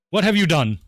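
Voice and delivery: loud, high cry of mock horror; shocked outburst. Player appalled at a neighbor having four kids, shocked outburst. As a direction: blurt it out, appalled.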